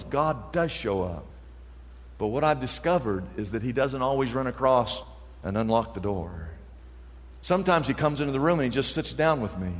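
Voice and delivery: quiet reflection. Retold or imitated.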